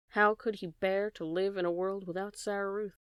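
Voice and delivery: ordinary delivery, nothing marked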